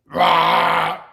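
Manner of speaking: a loud, rough, voiceless mock burp; imitated belch. Shown as a demonstration. Showing the rude belcher at the table, a loud fake belch. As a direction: bark out a fake belch from the throat.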